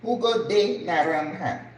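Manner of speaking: "Jugo de naranja" with Spanish j as h, HOO-go; Spanish pronunciation of "Jugo de naranja"; Spanish phrase. Shown as a demonstration. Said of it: "you say juice of orange".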